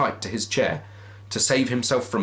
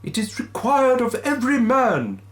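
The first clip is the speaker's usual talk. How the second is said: ghostly wail; 5 dB louder than usual. Acted. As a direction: intone it like a phantom. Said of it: "the ghost returned".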